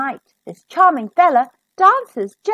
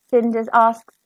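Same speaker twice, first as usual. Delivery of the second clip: plain reading voice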